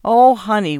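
loud, high pleading wheeze; winded, imploring cry; wheezed plea. A narrator's reading. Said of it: "wheezed Preacher".

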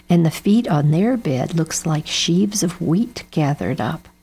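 plain reading voice

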